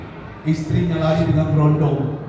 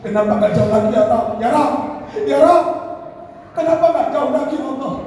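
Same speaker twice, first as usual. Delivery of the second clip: imitated crying plea, climbing higher; tearful dying lament; 8 semitones higher than usual. Dying husband pleads to Allah as retold by wife, weeping cry. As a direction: call out to God, rising into a high plea. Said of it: "dia menangis".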